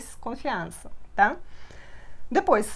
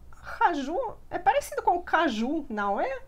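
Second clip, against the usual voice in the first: imitating a student; 7 semitones higher than usual. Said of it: "E ela falou".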